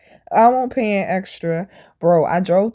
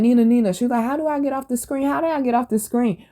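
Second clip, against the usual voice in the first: lightly voiced needy coworker, higher pitch; imitated pestering coworker; 5 semitones higher than usual. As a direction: mimic a coworker asking for help. Retelling coworker's repeated nagging question.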